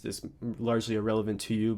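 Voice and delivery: ordinary talk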